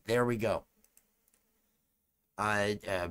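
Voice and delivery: ordinary delivery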